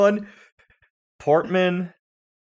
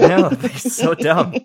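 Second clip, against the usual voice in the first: laughing; 6 semitones higher, 7 dB louder, slower than usual. Real, not acted. Laughing agreement.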